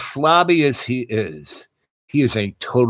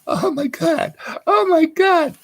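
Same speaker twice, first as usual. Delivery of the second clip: high, squealing delight, rising even higher; thrilled squeal. Retold or imitated. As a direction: squeal with stunned joy. Stoned wife's delighted reaction.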